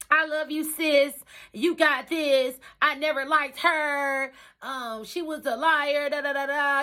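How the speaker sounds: high, gushing mock sweetness; mimicking fake friend. Imitating a friend who kept saying she's there, gushing. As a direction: lay the fake love on thick. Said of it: "Keep saying".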